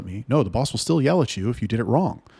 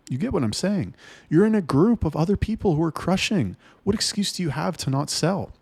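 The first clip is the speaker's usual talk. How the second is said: worked up; 8 semitones higher than usual. Real, not acted. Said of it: "I get worked up about this".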